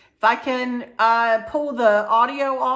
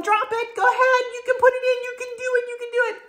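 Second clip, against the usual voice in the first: high-pitched imitation of a family cheering; excited high cheer, retold; faster than usual. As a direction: egg the little one on, pitched way up. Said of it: "We're all going".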